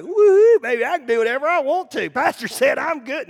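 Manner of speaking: high, loud whoop then fast gloating; mock carefree partier. Imagined congregant leaving church, mock high loud whoop. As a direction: play the reckless one cheering. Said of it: "that's not what I'm saying".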